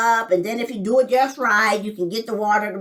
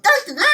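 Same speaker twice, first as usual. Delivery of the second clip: higher toy sound imitation; toy beeping; 9 semitones higher, much faster than usual. Describing brother's toy, higher beeping imitation. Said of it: "it will make this sound".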